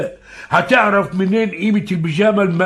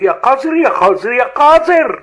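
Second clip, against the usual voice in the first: louder, higher repeated scolding; 8 semitones higher, 4 dB louder than usual. Acted. Father berating his son over the girl. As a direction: hammer each insult home.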